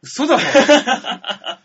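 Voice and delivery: loud, slow, laughing; disbelieving laugh. Real, not acted. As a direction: stretch out disbelief. Disbelief at joke.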